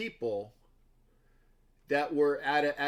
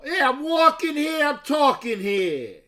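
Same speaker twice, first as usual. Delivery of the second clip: loud, high New York yell, dragged out; Dustin Hoffman impression; 11 semitones higher, 9 dB louder than usual. Streamer doing a Dustin Hoffman bit for his chat, yelled. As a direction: bellow it like an angry New Yorker blocking traffic. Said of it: "that's my impersonation of".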